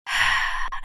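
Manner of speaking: heavy breathy sigh; thoughtful sigh. A narrator's reading. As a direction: sigh while thinking it over. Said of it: "فكر في كلامها واتنهد".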